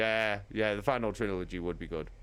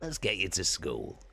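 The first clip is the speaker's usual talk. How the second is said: Tarek Targinen voice. Pretend. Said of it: "my fucking Tarek Targinen voice".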